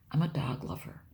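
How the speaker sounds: normal talking voice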